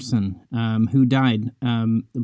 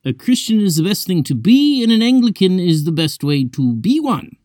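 put-on higher, lilting old Oxford English accent; Packer's English accent; 7 semitones higher, 3 dB louder than usual. Speaker recalls Packer's favourite saying in his Oxford English accent. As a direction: quote the professor in his posh English accent. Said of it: "in his accent".

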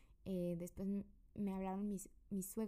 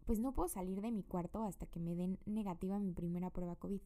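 usual speaking voice

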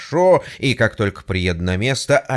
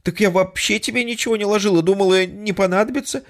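fast, higher defensive; making excuses; 10 semitones higher, faster than usual. Acted. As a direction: justify yourself hurriedly. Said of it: "заоправдывался голос". (оправдываясь)

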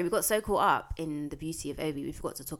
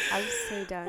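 high squeal of laughter; cracking up, almost crying; laughing. Real, not acted. Laughing at friend's joke.

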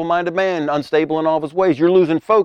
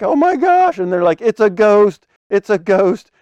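high, loud mock panic; hammed-up cry of alarm at a ghost; freaked out; 6 semitones higher, 4 dB louder than usual. Preacher acts out the disciples spotting a ghost, freaked out. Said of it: "Man, they freaked out".

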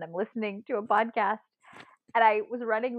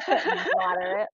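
"Moderate" drawn out slowly through laughter, higher; laughing disbelief; 11 semitones higher, much slower than usual. Retold or imitated. Scoffing at the watch's rating, laughing slowly. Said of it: "I couldn't even laugh".

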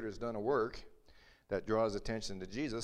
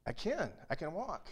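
rising up in disbelieving delight; amazed joy; 8 semitones higher than usual. Acted. Healed beggar finds he can walk, voice soaring with joy. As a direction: voice leaping high with amazed joy.

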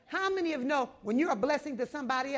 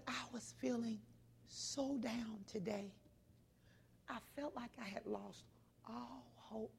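much softer, a sorrowful confession; hushed and sad; 17 dB quieter than usual. Retold or imitated. Sad old lady confides.

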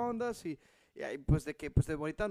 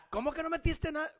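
higher accusing question; mock angry; 10 semitones higher than usual. Wife interrogating him about the microwave, mock angry. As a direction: imitate the angry wife, lightly. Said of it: "bien enojada".

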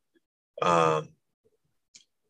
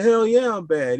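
defiant yell. Retold or imitated. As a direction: fire back a proud dare. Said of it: "I said".

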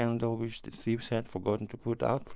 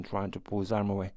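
ordinary delivery

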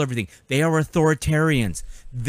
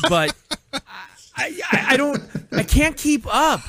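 normal talking voice

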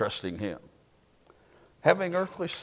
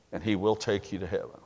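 ordinary talk, nothing marked

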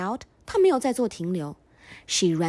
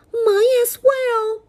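high, loud mother bear cry; Mommy Bear crying out; 13 semitones higher, 6 dB louder, slower than usual. Acted. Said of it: "cried Mommy Bear".

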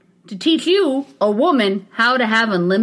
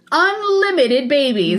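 slow, higher UNLIMITED BABIES, stretched out; emphatic repeat; 4 semitones higher, slower than usual. Acted. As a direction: repeat "Unlimited babies" slowly for a disbelieving listener. Repeats headline after co-host's incredulous what.

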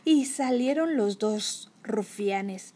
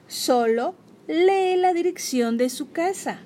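higher, slow, pressing repeat; insistent; 6 semitones higher, 4 dB louder than usual. Acted. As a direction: spell it out impatiently, word by word. Kenny repite, más insistente.

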